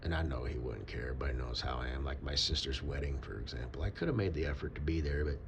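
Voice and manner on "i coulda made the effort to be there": ordinary talk, nothing marked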